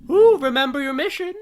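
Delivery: very high, loud radio character. Acted. Radio reminds players of mission, high loud radio voice. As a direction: squeeze it out high and loud, put on. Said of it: "the radio crackles once more and says".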